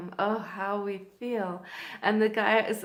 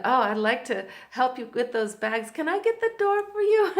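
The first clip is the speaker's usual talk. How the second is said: eager gallantry; 3 semitones higher, 7 dB louder than usual. Retold or imitated. Miami man offers help with bags, eagerly gallant. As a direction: imitate an admirer falling over himself to help.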